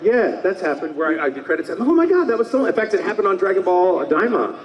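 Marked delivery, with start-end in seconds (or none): 1.8-2.6 high-pitched mock amazement; astonished reaction. Actor reliving spotting a name in the credits, amazed. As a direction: exclaim it like a sudden discovery.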